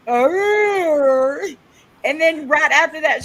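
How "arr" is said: a high, loud imitated growl; mock growl. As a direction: bark out a woman's silly growl. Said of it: "there's a woman that goes".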